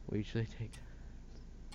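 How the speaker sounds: unvoiced embarrassed whisper. Embarrassed admission.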